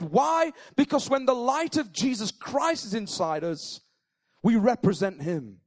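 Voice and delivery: fervent pulpit preaching; full-force preaching. Acted. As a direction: preach it out with fire to the congregation.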